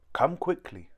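urgent call. A narrator's reading. Rose calls urgently.

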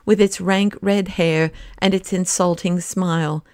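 ordinary delivery

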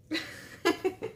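high breathy chuckle; little giggle after a teasing question. Real, not acted. Playful guess about favourite character.

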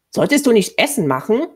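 put-on high, loud retort from a boy; snapped retort. A narrator's reading. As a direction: throw it back at her sharply. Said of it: "raunte Adalar sie an". (scharf angefahren)

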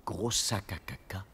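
a slow, hushed jeer behind his back; slow whispered taunt; sneering whisper. Acted. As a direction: whisper the insult under your breath, slow. Kid insulting the wolf in secret.

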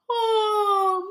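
high, loud yawned "oh"; big yawn. A narrator's reading. Little Bird worn out after sleepwalking, big yawn. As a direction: stretch the word into a mock yawn. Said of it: "Little Bird yawned".

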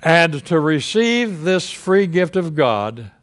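usual speaking voice

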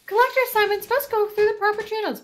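high, loud, panicky guard voice; high flustered guard. Acted. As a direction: squeal the protest up high.

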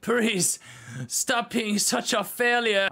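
high, loud, slow nagging mom voice; nagging mother. Acted. Mother scolding her son, high and drawn out. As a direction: drag it out like a disappointed mother.

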